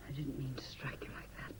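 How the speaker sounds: breathless, hushed apology; remorseful whisper. Acted. Wife apologizes in a whisper for striking husband. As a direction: whisper it, shaken by what you did. Wife after slapping him.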